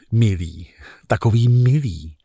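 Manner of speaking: soft, low loving words; tender caress. Retold or imitated. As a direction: whisper fondly to the engine. Tenderly strokes engine. (něžné šeptání motoru)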